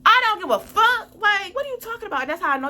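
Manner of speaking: high, loud, exasperated shout; angry outburst. Real, not acted. Angry outburst at ex's text.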